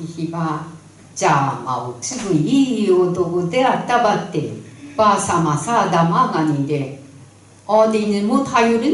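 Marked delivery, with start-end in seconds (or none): none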